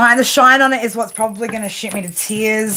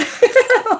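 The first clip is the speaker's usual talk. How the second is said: high laugh. Real, not acted. Amused at the confirmation.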